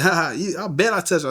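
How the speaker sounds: high-pitched imitated taunt. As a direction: mimic a cocky classmate's dare. Retold classmate taunting before a fight.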